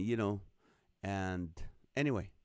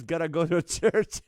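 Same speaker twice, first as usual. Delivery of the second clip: laughing through the punchline; breaking into laughter; 7 semitones higher, 6 dB louder than usual. Real, not acted. Host reading a viewer's parrot joke, breaking into laughter. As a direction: read it, cracking up at the end.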